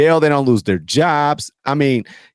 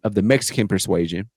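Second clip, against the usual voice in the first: ordinary delivery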